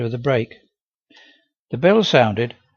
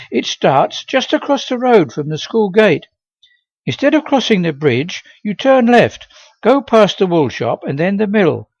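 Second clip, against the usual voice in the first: bright eager schoolgirl; chirpy helpful pupil giving directions; Molly; 8 semitones higher, 8 dB louder than usual. Acted.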